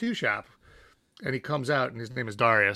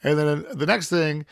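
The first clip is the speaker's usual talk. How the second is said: ordinary talk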